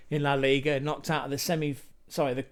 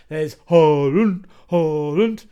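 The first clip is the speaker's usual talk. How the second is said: loud, slow, drawn-out cry of the name; excited shout; 11 dB louder, slower than usual. Real, not acted. Host spots Haaland on the graphic, shouting excitedly. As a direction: call his name out like a fan.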